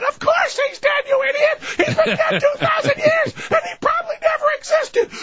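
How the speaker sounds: put-on very high, shrieked kid retort; screaming kid. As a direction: shriek back at the parent like a bratty child. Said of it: "their eight screaming kids".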